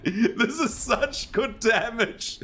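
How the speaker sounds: talking through laughter; laughing. Real, not acted. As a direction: giggle the words, delighted. Laughing over the damage.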